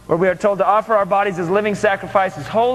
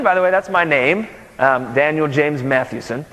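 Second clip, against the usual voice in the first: ordinary talk, nothing marked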